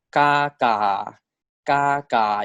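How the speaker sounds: "ka" in Thai first tone, steady level; tone one ka. Shown as a demonstration. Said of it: "if I want tone one".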